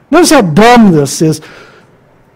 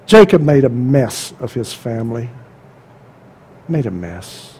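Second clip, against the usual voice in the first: hushed lament; 9 semitones lower, 7 dB quieter than usual. Real, not acted. Closing hushed point.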